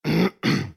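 tipsy king clearing his throat; preparatory harrumph. Acted. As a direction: clear the throat before an announcement. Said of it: "Он откашлялся, прочищая горло".

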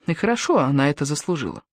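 ordinary delivery

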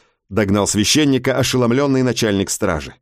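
usual speaking voice

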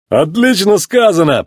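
slow, high cheer of agreement; fawning sidekicks. A narrator's reading. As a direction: egg the boss on. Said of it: "поддакивали его механики".

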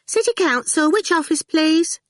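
sing-song phone greeting; a switchboard girl answering the line. A narrator's reading. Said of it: "switchboard girl answer".

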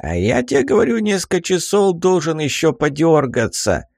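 put-on high cranky old man. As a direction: quarrelsome old geezer. Old man argues with crone.

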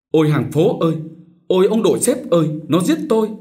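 high, loud terrified shrieks; shrieking for help. A narrator's reading. Daughters shriek for help as brothers fight. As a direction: scream for the neighbours. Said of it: "the thé".

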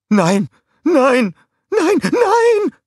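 very high, loud panicked screams; panicked screaming. A narrator's reading. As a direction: scream in terror before the blast. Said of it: "schrie Scarlett".

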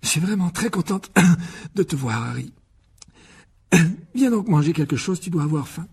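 high, strained welcome with throat-clearing; coughing, spent voice after screaming; voice broken from shouting. A narrator's reading. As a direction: greet the guest through a worn-out voice. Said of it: "lorsqu'elle se fut cassé la voix".

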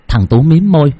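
ordinary delivery, nothing marked

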